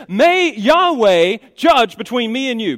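high, loud, slow declaration; pronouncing judgment. Acted. Wife calling God to judge her husband, pronouncing judgment. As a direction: call down judgment, slowly and forcefully. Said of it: "she pronounces judgment".

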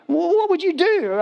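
high-pitched, flabbergasted questioning; incredulous. Acted. Preacher asks congregation about a donkey talking to them, incredulous. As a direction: voice shooting up in comic disbelief.

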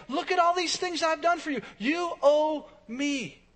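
high-pitched mock self-righteous plea; entitled demand. Churchgoer telling God what is owed, entitled demand. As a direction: insist on what is owed, slowing at the end.

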